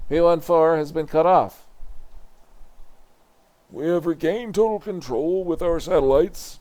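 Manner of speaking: high, loud tense report; tense officer. Acted. Defense room officer reporting the cut-off, high and tense. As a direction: report tightly under pressure.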